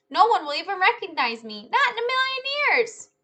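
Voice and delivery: high, loud and excited, soaring at the end; a proud kid sure his disguise is perfect; the child bragging to Mister Dart, loud and excited; boastful child. Acted. Stanley boasts about his disguise.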